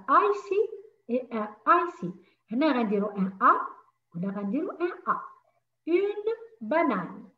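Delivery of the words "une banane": "Une banane" drawn out slowly, stress on "une"; slow model. Shown as a demonstration. Teacher repeating "Une banane" slowly for pupils. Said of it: "teacher models slowly".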